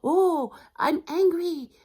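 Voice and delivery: loud, high, drawn-out exclamation; hammed-up exclamation on arriving for dinner; mock guest announcement. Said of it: "euh, vous dites".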